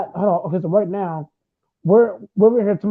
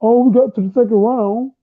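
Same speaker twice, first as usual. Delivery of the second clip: mocking Cowboys fan; 3 semitones higher, 4 dB louder, faster than usual. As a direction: mimic a gloating Cowboys fan. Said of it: "you sound like a Cowboys fan".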